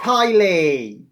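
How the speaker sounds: teacher snapping a pupil's name; warning teacher. Acted. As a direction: warn her with the name alone.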